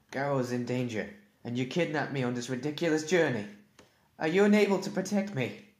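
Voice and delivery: building from sharp to a high, loud shout; rising anger that boils over; angry. Acted. Rider scolding his dragon, building to a high angry shout.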